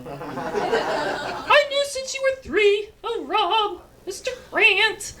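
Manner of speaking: high, slow wistful mom voice; Mary Tyler Moore. Retold or imitated. Son imitating his mother as Mary Tyler Moore, high and wistful. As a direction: sigh it like a sitcom mom. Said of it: "My mom's Mary Tyler Moore".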